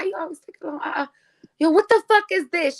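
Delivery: high, annoyed neighbor voice; high mock scolding. Neighbor cussing her out.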